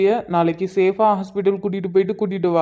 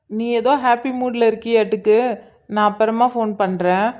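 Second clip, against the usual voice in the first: normal talking voice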